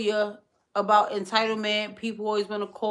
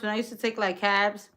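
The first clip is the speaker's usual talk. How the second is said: ordinary delivery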